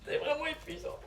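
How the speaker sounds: voix encore mouillée de larmes; après les pleurs. Real, not acted. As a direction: reniflant après avoir pleuré. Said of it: "mouche-toi". (nez pris après les pleurs)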